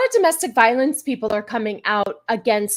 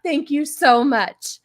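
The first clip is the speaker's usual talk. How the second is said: ordinary talk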